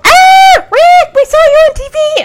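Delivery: high squealing fan shriek; shrieking fangirls. Retold or imitated. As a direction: scream like starstruck fans. Fangirls screaming at Rick.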